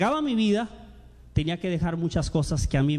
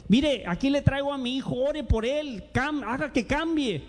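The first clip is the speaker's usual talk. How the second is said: pleading parent; 6 semitones higher than usual. Retold or imitated. Parents bring their son to the pastor.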